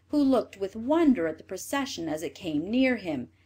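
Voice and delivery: ordinary delivery, nothing marked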